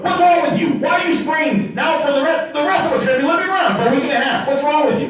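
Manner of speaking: high shouted scolding at his own foot; mock angry shout. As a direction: yell at the foot, half in jest. Body yelling at sprained foot.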